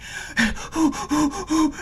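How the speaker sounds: high, breathy mock Lamaze gasps; hammed-up panic breathing on the ladder; panicked gasping breaths. Gasping for air while climbing down. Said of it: "being like".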